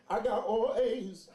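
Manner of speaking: normal talking voice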